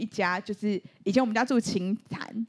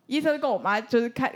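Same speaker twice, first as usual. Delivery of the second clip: normal talking voice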